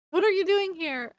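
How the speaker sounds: acting out a caught-off-guard question; startled demand. Aurora to her friends in the storage room, startled. Startled character confronts intruders.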